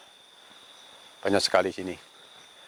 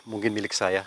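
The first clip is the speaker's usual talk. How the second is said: ordinary delivery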